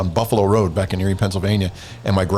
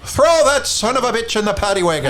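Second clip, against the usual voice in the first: higher, hammed-up Irish brogue; mock Irish cop; 9 semitones higher than usual. Copying old cops, hammed-up Irish accent. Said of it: "they all had Irish accents".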